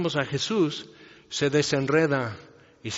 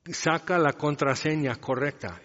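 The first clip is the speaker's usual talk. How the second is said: ordinary delivery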